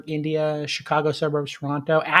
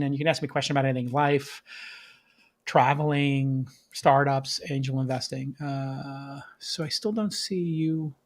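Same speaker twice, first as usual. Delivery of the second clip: ordinary delivery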